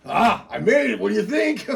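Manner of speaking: high, loud boast; crowing with pleasure; excited brother. Acted. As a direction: show off proudly to a sibling. Brother boasting, thinks he made it.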